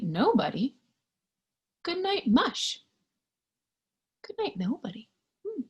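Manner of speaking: ordinary delivery, nothing marked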